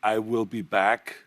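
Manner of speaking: slow "I will", two separate words; uncontracted I WILL. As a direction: spell out "will" in full, unhurried. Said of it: "can I say".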